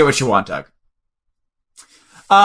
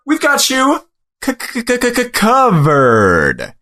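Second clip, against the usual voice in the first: stuttered tagline; 6 semitones higher, slower than usual. Shown as a demonstration. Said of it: "Like that?".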